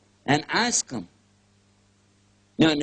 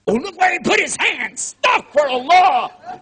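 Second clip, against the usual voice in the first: high, loud scandalized cry of astaghfirullah; hammed-up horror at a newcomer's hands; mock outraged shout; 12 semitones higher, 10 dB louder than usual. Regulars scolding newcomer's prayer.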